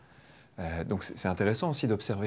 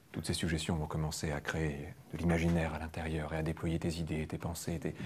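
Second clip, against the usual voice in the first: soft hypnotic voice; 6 semitones lower, 8 dB quieter than usual. Shown as a demonstration. Trainer shows a gentle induction voice to students, soft and low. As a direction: lower and softer hypnotic suggestion. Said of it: "Je vais pas parler de la même façon".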